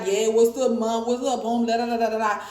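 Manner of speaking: lightly put-on New York accent; mock New York accent. Mocking how New Yorkers talk, a mild New York accent. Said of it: "If you're from New York, you're gonna be like".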